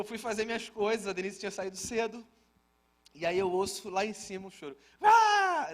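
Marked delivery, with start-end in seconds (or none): 5.0-5.7 high, loud fake crying wail of a child; imitating a child's theatrical cry; mock dramatic wail. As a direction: wail like a kid putting on a drama. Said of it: "Aquele choro do drama".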